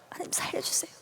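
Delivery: ordinary talk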